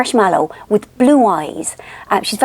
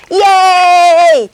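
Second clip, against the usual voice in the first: loud, high, stretched yay; baby's happy squeal; 8 semitones higher, 6 dB louder than usual. Retold or imitated. Imitating the baby's turn in the game, loud high squeal. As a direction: squeal yay like a delighted baby. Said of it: "She goes".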